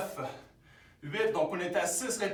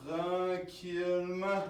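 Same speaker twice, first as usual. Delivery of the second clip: slowed right down; much slower than usual. Shown as a demonstration. Correcting a too-fast rep, coach says it very slowly. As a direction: stretch out tranquillement, very slow and drawn. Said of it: "un petit peu trop vite".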